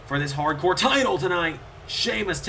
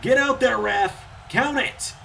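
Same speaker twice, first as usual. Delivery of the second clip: urgent plea; 6 semitones higher, slower than usual. Real, not acted. Commentator urging the late referee, urgent shout. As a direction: yell at the ref to hurry.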